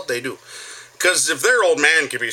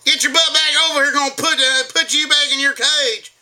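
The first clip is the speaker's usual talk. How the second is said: high, loud mock hillbilly holler; Southern twang yell; 6 dB louder than usual. Getting a kid's attention in public, hammed-up Southern yell. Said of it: "random twang in there from the South".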